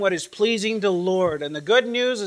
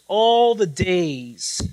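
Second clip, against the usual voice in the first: slow, louder All the days; slow and emphatic; 4 dB louder, much slower than usual. Shown as a demonstration. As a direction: draw out "All the days" slowly. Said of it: "Literally, it reads".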